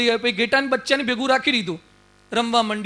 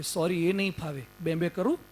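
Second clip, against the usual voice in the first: timid lamb voice; 5 semitones lower, 9 dB quieter than usual. Acted. Cub meekly answers the lion, in a small, much softer lamb voice. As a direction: lower and much softer, meek little voice.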